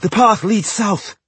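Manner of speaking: high, slow, effortful call; urgent, out-of-breath direction; panting. A narrator's reading. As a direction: pant it out to companions on the run. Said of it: "he panted".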